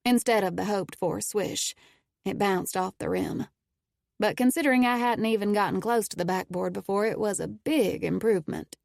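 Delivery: ordinary delivery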